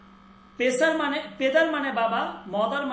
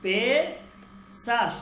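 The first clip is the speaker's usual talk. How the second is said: Pacer drawn out very slowly; slow model pronunciation; much slower than usual. Shown as a demonstration. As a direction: stretch each sound of pacer. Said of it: "teacher drills the word". (Pacer ধীরে টেনে উচ্চারণ)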